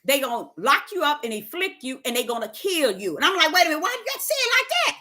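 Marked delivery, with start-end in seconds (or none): 3.5-5.0 fast, much higher startled query; pushing back at God, fast and high; startled question. Pretend. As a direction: blurt it out in alarm.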